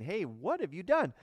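mock casual inquiry, as if seeking information; casual question. Shown as a demonstration. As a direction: ask it like you just want to know. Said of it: "He's not seeking information from Cain".

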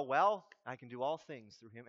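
low, casual self-assurance; mock exam-taker. Preacher plays unprepared student sitting an exam, low mock confidence. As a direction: drop low as if reassuring yourself. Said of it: "go and sit and go".

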